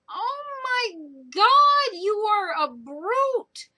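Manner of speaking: high, loud, very slow outraged gasp; shocked outrage. Retold or imitated. Reviewer appalled at the father slapping his son, high slow outrage. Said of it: "And I'm like".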